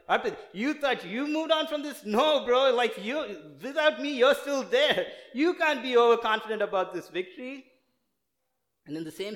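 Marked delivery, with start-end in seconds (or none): 0.1-7.6 much higher, louder voice of the Lord rebuking him; the Lord convicting. Retold or imitated. The Lord convicting Arpit for losing his cool, loud high rebuke. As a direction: play God scolding him from way up high, loud and firm. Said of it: "the Lord was convicting me so much, like".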